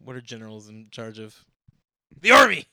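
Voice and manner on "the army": sudden high, very loud yell; very loud shout. Pretend. Host answers own question about generals, sudden shout. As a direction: blurt it out at full volume. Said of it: "That was so loud".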